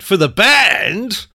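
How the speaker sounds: high, loud, drawn-out mock voice; exaggerated repeat. Retold exchange, words repeated exaggeratedly.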